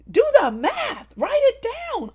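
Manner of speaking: loud, high, slow mom yell; loud, high scolding, Do spaced out; scolding shout. Retold or imitated. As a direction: bark it at the kids like a fed-up mom. Scolding the boys.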